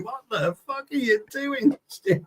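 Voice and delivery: high, drawn-out comic outcry; hammed-up slow, high-pitched protest; mock alarmed protest. Imagined victim's panicked protest.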